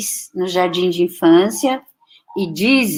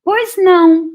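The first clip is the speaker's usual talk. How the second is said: gentle teacher; 10 semitones higher, 7 dB louder than usual. Retold or imitated. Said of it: "a professora gentilmente olhou pra mim".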